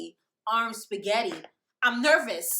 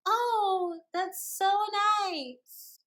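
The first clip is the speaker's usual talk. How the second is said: high, very slow sweetness; fake sweet; 8 semitones higher, much slower than usual. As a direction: drag it out falsely sweet. Faked pleasantness.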